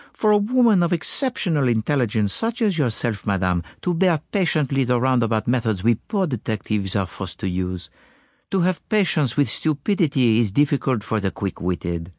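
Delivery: put-on soothing flattery in a slight foreign accent; smooth ruffled feelings with courtly praise; a hint of a courteous continental sleuth; lightly voiced tactful detective. Poirot soothes Mrs Todd with flattery.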